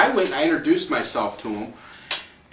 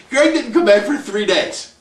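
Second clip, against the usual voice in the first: ordinary delivery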